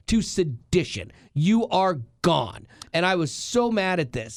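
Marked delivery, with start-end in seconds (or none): 1.3-2.7 very slow, each word punched; slow, stressed three-word verdict; slow emphatic verdict. Real, not acted. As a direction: hand down the sentence word by word. Ranting about expelling protesters.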